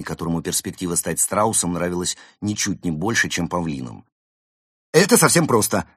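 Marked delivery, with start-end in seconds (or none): 4.9-5.9 high, loud, confident assurance; assuring. A narrator's reading. Panda assuring the reluctant penguin, confident and insistent. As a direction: brush the worry away brightly, raised pitch. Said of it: "заверил его панда".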